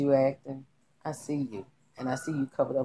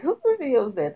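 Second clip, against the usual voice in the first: much higher, incredulous; stunned disbelief; 11 semitones higher, 5 dB louder than usual. Real, not acted. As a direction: ask it baffled and loud. Reacting in disbelief.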